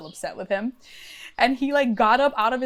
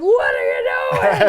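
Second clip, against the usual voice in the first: much lower, slower and louder; uncle's voice; 9 dB louder than usual. Retold or imitated. Uncle sees her screaming in her bra, low slow voice. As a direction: boom it out like a puzzled grown man.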